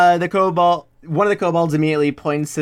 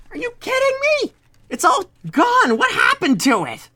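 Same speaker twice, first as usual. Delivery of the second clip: much higher, squeaky kobold griping; high kobold grumbling over lost loot; upset kobold. Acted. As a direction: whine like a robbed little kobold. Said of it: "hear 'em going like".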